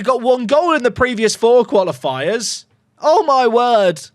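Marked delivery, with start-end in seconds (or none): 3.0-4.0 slow, drawn-out awe; amazed. Real, not acted. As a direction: let it sink in slowly. Stunned by a goal.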